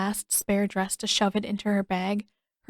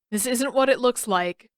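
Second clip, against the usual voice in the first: too loudly; 3 semitones higher, 3 dB louder than usual. A narrator's reading. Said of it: "he said too loudly".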